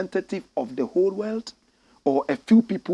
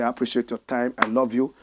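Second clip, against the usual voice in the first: ordinary talk, nothing marked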